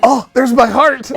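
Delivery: loud, high, drawn-out fake alarm; mock heart scare. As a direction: play a hammed-up heart attack. Jokes his heart is acting up.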